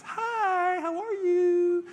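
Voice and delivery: very high, slow coy greeting; flirty female tiger. Retold or imitated. Female tiger flirting back at the male, high and coy. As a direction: coo like a flirt. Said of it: "She goes".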